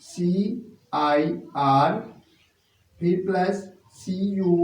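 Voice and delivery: very slow letter-by-letter dictation; teacher spelling a long word; spelling out slowly. Shown as a demonstration. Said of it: "लिखना चाहते हैं circumstances".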